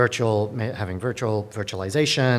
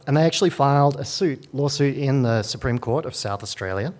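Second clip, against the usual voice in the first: ordinary talk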